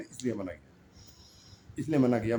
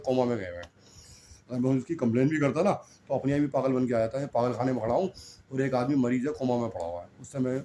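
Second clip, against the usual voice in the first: ordinary talk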